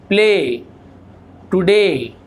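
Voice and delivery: normal talking voice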